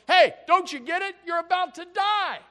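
one thief yelling at the other on the cross; loud rebuke. Acted. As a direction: shout the warning across to him. Thief calls across to other.

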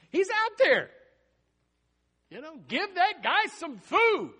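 high, loud exhortation; exasperated shout. Acted. Preacher urging praying folks about Peter outside, exasperated shout.

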